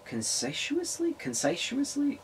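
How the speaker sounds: high puzzled rising "conscientiously?" questioning the word; puzzled self-check. Real, not acted. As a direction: doubt your own reading of "Conscientiously?", pitch way up. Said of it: "Conscious-, consciously".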